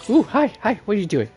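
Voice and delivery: normal talking voice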